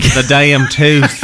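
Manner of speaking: slow, teasing Southern drawl; mock Southern accent. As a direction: mimic the cohost going down south. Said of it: "You're really going down south".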